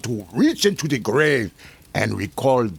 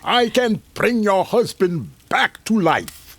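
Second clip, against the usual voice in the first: grand declaration; 8 semitones higher, 4 dB louder than usual. Acted. Doctor boasts power.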